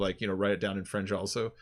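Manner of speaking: normal talking voice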